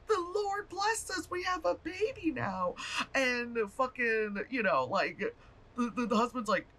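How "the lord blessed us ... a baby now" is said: very high, slow sing-song piety; pious wife. Retold or imitated. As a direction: coo it like a blissfully devout wife. Wife voiced, pious excuse.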